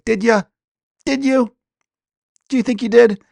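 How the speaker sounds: high-pitched sceptical needling; pointed, high mocking doubt. Mocking those who claim they're okay.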